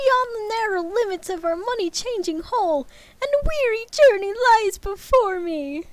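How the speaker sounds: high, hammed-up ghost voice; a wailing ghost warning Scrooge; ghost of Marley. Marley's ghost warns Scrooge in high hammed-up voice.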